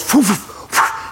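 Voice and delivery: slow breathy whooshes of wings; eagle wingbeats. Retold or imitated. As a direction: blow out the sound of wingbeats. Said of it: "and then goes".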